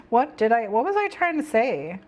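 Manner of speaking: normal talking voice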